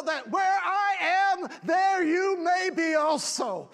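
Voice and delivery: shouted, high climax of the promise; too loud. Real, not acted. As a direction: belt the promise out at the peak. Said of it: "I'm getting too loud".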